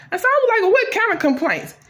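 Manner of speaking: high, indignant squeal; high-pitched indignant question; shrill indignation. Acted. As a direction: shoot up high in outrage. [name] indignant at manager.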